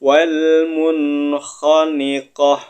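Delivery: slow chanted Arabic; Yu'jibuk recited slowly, final kaf breathed out; Quran recitation. Shown as a demonstration. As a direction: intone it like a tajwid teacher. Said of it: "Kita pindah ke sebelahnya".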